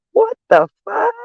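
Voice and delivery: squeaky high "What the fuck?" like a startled cartoon; mock shocked squeak. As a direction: shriek it as a puzzled inner voice, high. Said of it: "I think to myself".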